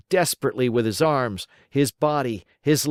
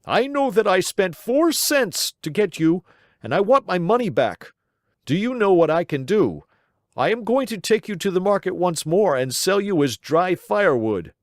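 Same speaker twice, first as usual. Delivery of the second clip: higher, heated scolding of a cheated master; angry man; 8 semitones higher, 3 dB louder than usual. Acted. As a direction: demand the money back, fuming. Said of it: "cried the man angrily".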